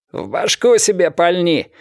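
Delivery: high-pitched hostile retort; angry taunt. A narrator's reading. Captain taunts during gunfight.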